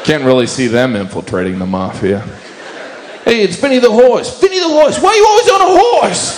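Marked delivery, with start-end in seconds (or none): 3.2-6.4 very high, shouty Italian American mobster; hammed-up Italian American shout, pitched way up; bad Italian American accent. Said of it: "worst Italian American impression I've ever heard".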